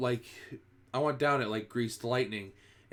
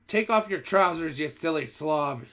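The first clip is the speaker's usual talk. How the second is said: higher, louder mocking shout; 5 semitones higher, 5 dB louder than usual. A narrator's reading. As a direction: bark it across the kitchen. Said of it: "someone else shouted".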